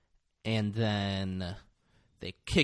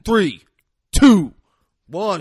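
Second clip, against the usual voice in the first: high, loud countdown; shouted countdown; 8 semitones higher, 13 dB louder, slower than usual. Retold or imitated. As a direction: bark the count down at him.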